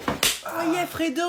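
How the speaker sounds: very high, squealing ecstasy; high-pitched lover's cry; mock ecstasy. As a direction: squeal it in feigned pleasure. Mock lovemaking overheard.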